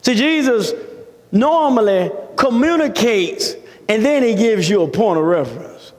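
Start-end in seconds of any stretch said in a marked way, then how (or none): none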